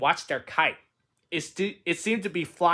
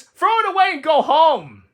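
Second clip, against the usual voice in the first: high, mocking little bird voices; a robin heckling from the bush, high and mocking; jeering robins; 11 semitones higher, 8 dB louder than usual. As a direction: jeer at Toad like a cheeky robin. Robins mock third time.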